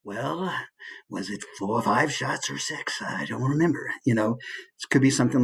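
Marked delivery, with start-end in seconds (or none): 0.0-3.9 clenched-teeth tough guy impression; understated, talking through gritted teeth; Clint Eastwood. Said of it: "Clint Eastwood".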